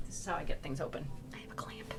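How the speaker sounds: soft whisper, no voice; breathy secret whisper. Real, not acted. Whispering showing a trick.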